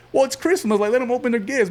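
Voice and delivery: imitating grandma asking to let the kids open gifts; high pleading grandma voice; grandmother pleading. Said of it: "you hear my grandmother like".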